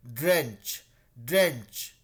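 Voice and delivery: ड्रेंच with the mouth pulled sideways, stressed; crooked-mouth pronunciation. Shown as a demonstration. Teacher shows twisted mouth for ड्रेंच to students. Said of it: "थोड़ा मुंह टेढ़ा करके बोलते हैं".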